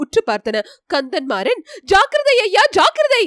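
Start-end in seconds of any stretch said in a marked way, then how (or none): 1.8-3.2 high, loud cry of warning; shouted warning. A narrator's reading. Companion warns the prince as two boars appear, shouted warning. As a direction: call out urgently to beware. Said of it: "என்று கூச்சலிட்டான்".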